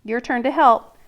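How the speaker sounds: normal talking voice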